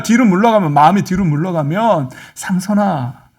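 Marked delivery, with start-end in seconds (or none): 2.3-3.3 hushed, sorrowful entreaty; grieving, pleading God. Acted. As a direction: plead gently with a beloved child, nearly whispering in places. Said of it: "속상해 하신다는 거예요".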